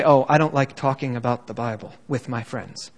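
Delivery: low, quiet imagined objection; mock reluctance. Preacher voicing a friend shying from Bible talk, mock reluctant.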